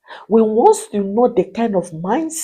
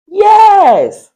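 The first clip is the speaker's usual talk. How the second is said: much higher, louder exclamation; emphatic yes; 10 semitones higher, 10 dB louder than usual. Real, not acted. Coach to wives, a loud high shouted yes.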